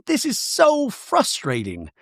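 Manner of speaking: high, slow, whiny put-on voice; mock frustrated wife. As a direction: draw it out high, imitating her exasperation. Voicing frustrated Bronwyn.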